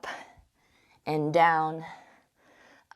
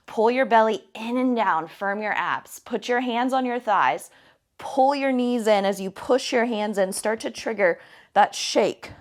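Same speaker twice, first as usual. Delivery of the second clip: ordinary talk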